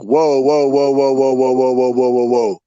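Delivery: rapid unbroken run of whoas; string the whoas together on one held note; alarmed interruption. Retold or imitated. Friend cuts in on worrying phone call, alarmed interruption.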